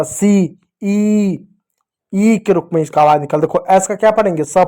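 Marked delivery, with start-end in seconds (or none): 0.0-1.5 long held "ई", as in "सी"; forceful long vowel. Shown as a demonstration. Teacher shows children the bold double E sound, long "ई". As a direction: stretch the vowel of "सी" and "ई" firmly. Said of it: "इसका जोर से आवाज़ निकलता".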